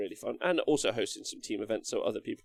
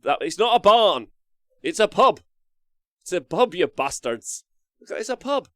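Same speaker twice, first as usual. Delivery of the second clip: much higher and louder mock outrage; indignant correction; 7 semitones higher, 10 dB louder than usual. Real, not acted. Streamer correcting chat about the building, loud mock indignation. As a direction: insist it's a pub, playfully furious.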